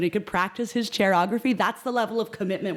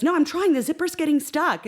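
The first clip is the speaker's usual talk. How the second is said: flustered; 9 semitones higher than usual. Retold or imitated. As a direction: play the panicked helper fighting a zipper. Friend struggling with dress zipper.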